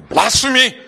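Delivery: hammed-up indignant shout; mock outrage. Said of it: "many a commentary that I go".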